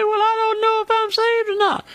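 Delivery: high, mock-anxious voice of a doubter; doubtful listener. Preacher mimicking a doubting listener, high and anxious. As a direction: play the unsure listener, up high. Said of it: "You say".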